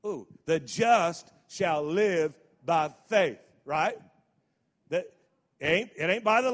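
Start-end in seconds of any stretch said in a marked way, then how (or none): 0.4-3.4 slow, loud and weighty; slow emphatic recital. Shown as a demonstration. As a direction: lay each word down slowly. Scripture recital.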